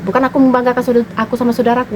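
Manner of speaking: ordinary delivery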